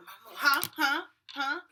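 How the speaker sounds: loud, rising mock huhs; mock obtuse clerk. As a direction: imitate a clerk who acts like she can't hear. Retold booth clerk's rude response.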